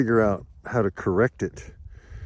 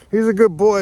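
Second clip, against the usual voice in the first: higher and louder cheery praise; bright dog praise; 9 semitones higher, 6 dB louder than usual. Real, not acted. Praising Spike, then the joke.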